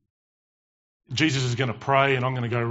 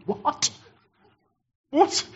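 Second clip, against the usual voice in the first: mock disbelief; 13 semitones higher than usual. Reacting to Jesus' rebuke of Peter.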